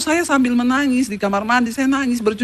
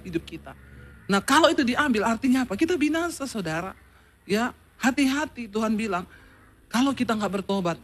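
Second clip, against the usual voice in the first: hushed, intimate preaching, much softer; soft altar call; 5 semitones lower, 7 dB quieter than usual. Acted. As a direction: draw them in gently toward repentance.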